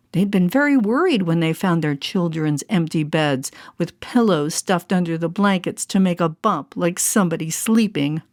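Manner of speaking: ordinary delivery, nothing marked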